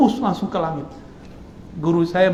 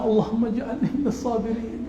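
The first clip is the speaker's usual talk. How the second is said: mock pious prayer; 5 semitones higher, 4 dB quieter than usual. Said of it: "Jadi jangan bilang".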